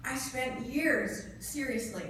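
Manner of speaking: ordinary talk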